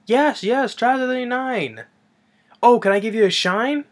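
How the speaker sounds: put-on high-pitched eager boy voice; station kid. Kid answering the traveller at the station, high boy voice. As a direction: pipe up like a shoeshine kid.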